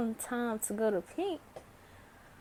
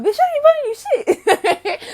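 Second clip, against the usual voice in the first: much higher and louder, cackling defiance; laughing shout; 13 semitones higher, 16 dB louder, faster than usual. Retold or imitated. Teen laughing off the birthday girl's demand, loud laughing shout. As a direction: scream it through laughter.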